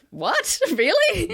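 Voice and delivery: high, incredulous "What? Really?" with a laugh; stunned disbelief. Retold or imitated. Quoting a comedy hero's stunned reply, high and surprised. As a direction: play it genuinely caught off guard. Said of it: "And she was like".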